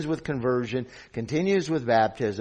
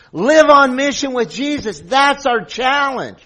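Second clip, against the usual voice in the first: raised, louder pulpit voice; forceful preaching; 9 semitones higher, 9 dB louder than usual. Real, not acted. Preacher tells the church of its commission, preaching forcefully. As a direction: drive the point home to the congregation.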